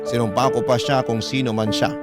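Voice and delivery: normal talking voice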